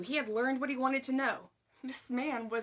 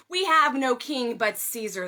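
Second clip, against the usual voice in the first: much louder defiant cry; mob shout; 10 dB louder than usual. A narrator's reading. Enraged mob answering Pilate, loud defiant shout. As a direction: fling it back as an angry crowd.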